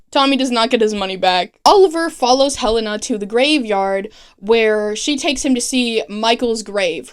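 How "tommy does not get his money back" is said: usual speaking voice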